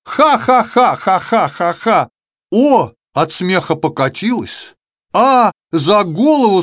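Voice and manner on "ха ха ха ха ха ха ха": ha-ha laughter. Acted. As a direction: burst out laughing at Я. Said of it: "Как зальётся буква Х".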